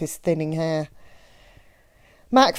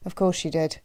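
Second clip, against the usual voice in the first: usual speaking voice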